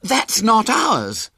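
high, loud, drawn-out alarm; slow it right down, high and loud; excited cry. A narrator's reading. Henry telling friends it's the wrong baby, excited cry. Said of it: "he cried excitedly".

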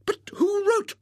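high, brisk lady's voice; bustling spinster. Acted. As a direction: hurry on like an excited old maid. Miss Rubina questions Mr Graham.

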